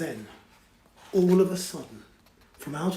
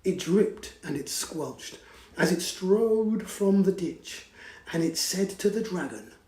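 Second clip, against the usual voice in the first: ordinary delivery, nothing marked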